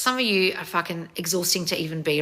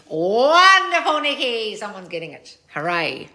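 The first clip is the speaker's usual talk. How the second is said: gushing praise; slower than usual. Real, not acted. Congratulates a listener by name.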